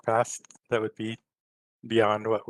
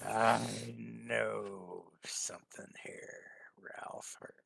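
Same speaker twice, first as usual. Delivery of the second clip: whisper; 10 dB quieter, slower than usual. Acted. Dramatic whispered realization.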